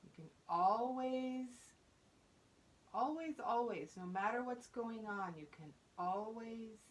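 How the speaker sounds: measured meditation voice with long pauses; guided mindfulness. Real, not acted. Teacher leading fourth graders to their breath, calm guidance.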